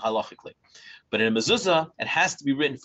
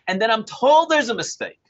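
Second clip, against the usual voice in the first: indignant; 10 semitones higher, 5 dB louder than usual. Real, not acted. Indignant retelling of the mistake.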